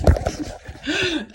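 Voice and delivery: a sudden cackle at her own joke; sharp amused laugh at the jab; high burst of laughter. Real, not acted. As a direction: laugh out loud, high and bright.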